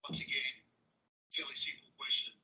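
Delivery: hushed mock whisper of the officer's line. Mocking the officer's question in a whisper. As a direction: whisper it under your breath. Muttered aside repeating officer.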